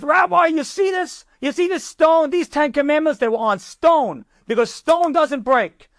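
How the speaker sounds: high, heated lecture; retold angry rant, pitched well up; angry general. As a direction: act out a general laying down the law. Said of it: "he's got so angry".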